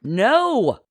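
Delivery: commanding, drawn-out no; ordering over din. A narrator's reading. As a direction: call it out over a noisy crowd. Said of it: "Marcy ordered over the din".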